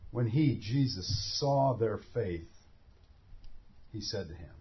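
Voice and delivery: hushed, slow scripture reading. Shown as a demonstration. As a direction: read slowly, lowering. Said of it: "Verse twenty".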